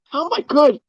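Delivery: very high-pitched squealed protest; comic falsetto yell of pain and outrage; retold yell. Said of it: "And I fucking yelled, I'm like".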